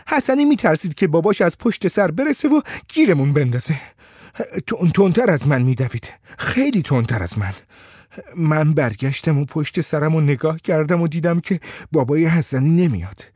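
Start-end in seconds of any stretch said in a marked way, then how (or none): none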